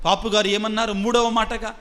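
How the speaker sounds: usual speaking voice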